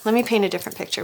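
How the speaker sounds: ordinary delivery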